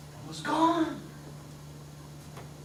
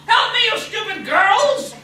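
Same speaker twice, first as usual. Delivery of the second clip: loud, higher scolding dwarf voice; angry dwarf; 11 dB louder than usual. Acted. Dwarf scolds the staring girls, loud angry rant. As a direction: berate the gaping girls.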